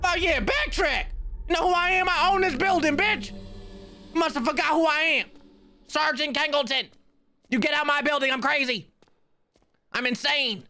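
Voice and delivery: very high, squealing tirade; frantic high-pitched shouting. Real, not acted. Player telling the tall ghost to move, frantic high shrieking bluster. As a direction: shout down a monster in a panicked squeal.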